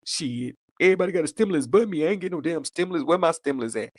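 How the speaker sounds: fast, fuming ex; mock grumbling baby daddy; imitating the ex. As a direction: rattle off the ex's complaint. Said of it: "He was like".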